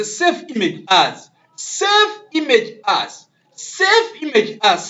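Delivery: "Save image as" drawn out, high and emphatic; emphatic dictation. Shown as a demonstration. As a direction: SAVE IMAGE AS, slow and loud, each word separated. Teacher dictating the menu command.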